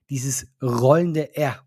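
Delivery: ordinary talk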